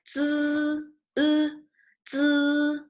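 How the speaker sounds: "zi" and "e" held long, high and level; zi with e sound. Shown as a demonstration. Teacher breaking the syllable down for learners, zi with e sound. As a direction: stretch "zi", isolate the "e" vowel, then "zi" whole. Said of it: "but with like an e sound".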